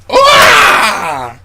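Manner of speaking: high, loud drawn-out whoop; long victory scream; triumphant yell. Real, not acted. Drinker whoops after the high five, high loud yell.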